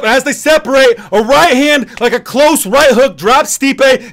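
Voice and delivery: loud, high, still buzzing replay call; excited recap. Real, not acted.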